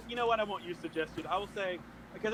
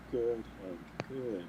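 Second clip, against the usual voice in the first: low, soft, creepy-tender murmur; hushed, caressing in-character voice; soothing praise; 7 semitones lower, 5 dB quieter, slower than usual. Acted. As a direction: praise him like a pet, low and gentle. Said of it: "caressing his, like, his, his jaw".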